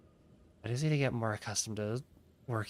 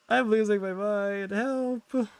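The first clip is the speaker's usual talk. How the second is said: yawning; 10 semitones higher, 5 dB louder, slower than usual. Real, not acted. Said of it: "can't stop yawning".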